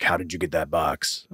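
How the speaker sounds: low, soft villain voice; mock-sinister villain, hushed and low; evil villain. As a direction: drop suddenly into quiet menace. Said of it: "evil, um, villain mode".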